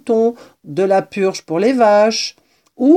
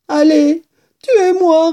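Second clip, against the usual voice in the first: very high, louder plaintive cry; despairing mouse; 10 semitones higher, 6 dB louder, slower than usual. A narrator's reading. Exhausted mouse telling the kitten to kill her, high despair. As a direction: beg for the end in a high little voice. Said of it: "répondit la souris dans un souffle".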